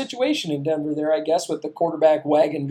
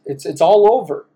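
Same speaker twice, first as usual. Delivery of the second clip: ordinary talk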